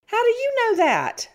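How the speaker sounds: high-pitched, pressing question; indignant challenge. Real, not acted. Host disputing co-host's kidnapping claim, indignant challenge. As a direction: challenge him with voice shooting up high.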